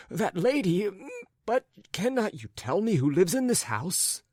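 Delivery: high, animated character voice full of fuss; flustered raccoon-coated gentleman. Acted. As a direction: play a fretful, wounded gentleman.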